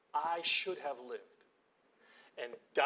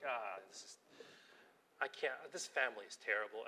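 lower, softer disgusted mutter; grumbling; 5 semitones lower, 7 dB quieter than usual. Retold or imitated. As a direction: sulk under your breath about the family. Grumbling at messy house.

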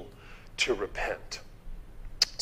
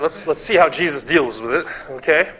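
ordinary talk, nothing marked

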